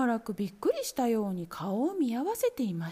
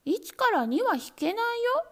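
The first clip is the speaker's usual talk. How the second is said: high, louder kitten chorus; kittens shouting; 10 semitones higher, 4 dB louder than usual. A narrator's reading. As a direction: correct him all at once. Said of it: "一度に叫びました".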